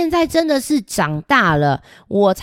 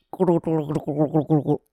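gargling; 4 semitones lower, 6 dB quieter, much faster than usual. Acted. Gargling after brushing.

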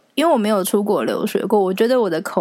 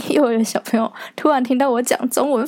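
normal talking voice

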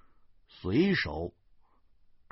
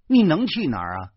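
louder, higher repeat; raised-voice demand; challenging question; 6 semitones higher, 7 dB louder than usual. A narrator's reading. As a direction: throw it out as a loud challenge.

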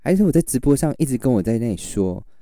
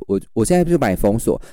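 ordinary delivery

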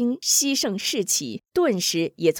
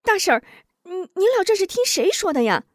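high, worried asking; anxious question; 11 semitones higher, 4 dB louder, faster than usual. A narrator's reading. Han Jing anxiously asking the aunt, high worried voice. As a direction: press her anxiously, pitched up. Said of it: "着急地问".

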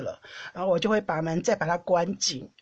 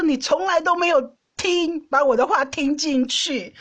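retold angry scolding; 6 semitones higher than usual. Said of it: "暴怒".

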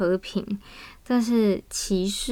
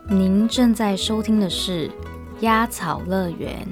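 radio host intro. Acted. Podcast intro over music.

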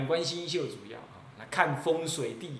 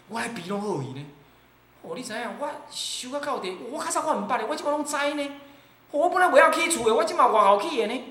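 much higher, fast, puffed-up bragging in Taiwanese; boastful bragger; 8 semitones higher, 4 dB louder, faster than usual. Acted. Monk mocks a cultivator boasting of his attainments, higher and boastful. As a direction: crow about your powers.

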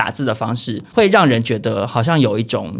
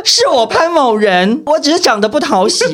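mock grand appeal; 7 semitones higher, 4 dB louder than usual.

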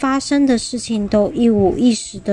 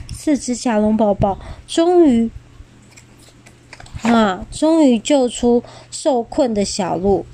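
usual speaking voice

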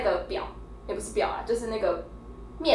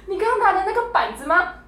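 higher re-take of mom's question, a little smoother; imitating mom's disbelieving question once more; mom's question again; 10 semitones higher, 3 dB louder than usual. Retelling mom's question at the checkout, high and incredulous. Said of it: "这个结巴是我妈结巴".

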